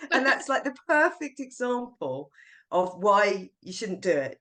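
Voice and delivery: ordinary talk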